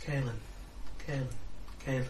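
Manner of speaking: distant, drowsy calling of a name; far away and tired. Acted. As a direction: call the name as if from very far off, half asleep. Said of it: "Sounding very far away and tired".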